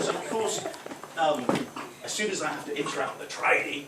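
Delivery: usual speaking voice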